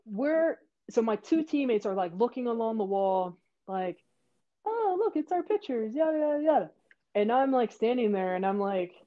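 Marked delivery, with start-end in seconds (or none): 4.6-6.7 much higher-pitched teammate voice; mock oohing over pictures, high and light; mimicked excited chatter. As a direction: imitate a friend's idle excitement. Teammates admiring pictures, acted.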